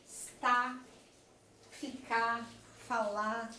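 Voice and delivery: está, ficar, falar with the final R left off; dropped final R. Shown as a demonstration. Teacher showing the class the dropped R: fica, fala. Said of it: "a queda do R final".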